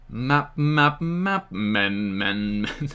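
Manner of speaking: slow, stretched-out Map Men; drawn-out playful repeat. Pretend. Teasing chat about Map Men, slow and stretched. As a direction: drag the name out, playing with it.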